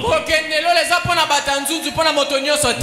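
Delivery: match the preacher's fire; an interpreter carried along by the sermon; fervent interpreting. Real, not acted. Interpreter echoes preacher's climax.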